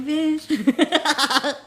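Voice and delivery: high laughing mock snap. Caller imitating herself scolding, laughing. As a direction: toss it off high while laughing. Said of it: "I'm like".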